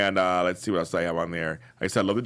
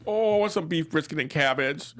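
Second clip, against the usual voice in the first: high-pitched, longing "oh" into the wish; hungry delight, voice lifted high; eager craving; 8 semitones higher than usual. Retold or imitated. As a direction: act out a sudden food craving, pitched up. Acting out craving for the dinner.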